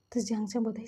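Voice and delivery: ordinary delivery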